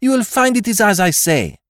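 high, loud, sharp insistence; heated insistence. A narrator's reading. Chambermaid insisting to the inspector, loud and high. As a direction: hammer the point home. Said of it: "screamed the other".

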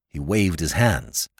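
usual speaking voice

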